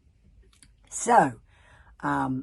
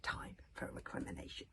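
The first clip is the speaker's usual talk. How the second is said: usual speaking voice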